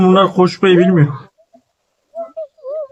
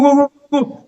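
ordinary talk

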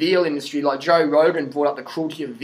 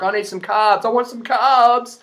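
high, louder, drawn-out mock whine; whiny carb craving; 7 semitones higher, 5 dB louder than usual. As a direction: beg for carbs like a starving camper. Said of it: "they're like".

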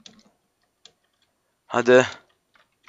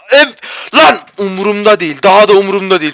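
angry defiance; 7 semitones higher, 5 dB louder, faster than usual. Real, not acted. Raging at the game.

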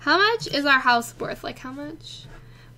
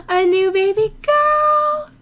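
high, loud and slowed with a gasp first; gasp, then announce with joy; excited gasp; 9 semitones higher, 7 dB louder, much slower than usual. Real, not acted. Announcing the newborn girl to viewers, excited. Baby born in game.

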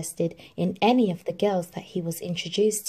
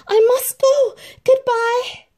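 high, loud rushed farewell; hurried Cinderella; 9 dB louder, slower than usual. Acted. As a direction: dash away breathless. Cinderella fleeing at midnight.

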